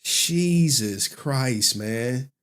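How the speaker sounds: drawn-out exasperated Jesus Christ; slow exasperated sigh. Real, not acted. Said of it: "Why y'all got me talking like this".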